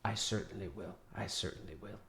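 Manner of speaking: low, very soft assurance; quiet promise. Acted. Yarth assuring the worried friends, low and quiet. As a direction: promise it under your breath.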